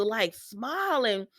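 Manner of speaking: normal talking voice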